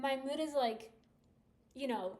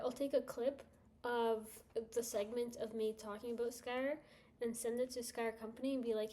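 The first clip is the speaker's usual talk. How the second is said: ordinary delivery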